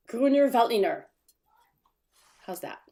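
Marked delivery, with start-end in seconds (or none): 0.1-1.1 loud, higher, mildly aggressive German delivery; confident German. Shown as a demonstration. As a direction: say it boldly, with a little aggression. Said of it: "just say it with confidence".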